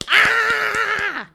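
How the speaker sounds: sharp high mock scream of a toddler; imitated child scream. Mother imitating her daughter's scream under the door, high shriek.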